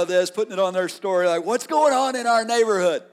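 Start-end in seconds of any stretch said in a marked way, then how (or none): 1.4-3.0 gossipy neighbor, higher and louder; excited onlooker asking what's up. Retold or imitated. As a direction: play the nosy neighbor filming. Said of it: "neighbors are out with their phones".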